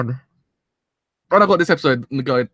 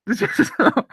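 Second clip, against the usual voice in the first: teary laugh; 11 semitones higher than usual. Real, not acted. Laughing through tears.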